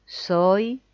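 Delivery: drawn out, one word per breath with long gaps; one syllable per breath. Shown as a demonstration. Therapist demonstrating the thought, one breath per word. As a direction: say each word on its own breath. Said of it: "pronunciar una sílaba por respiración".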